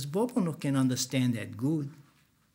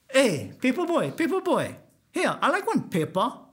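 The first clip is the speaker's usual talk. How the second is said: 1 mocking call; 8 semitones higher than usual. Driver calls Bobo mockingly.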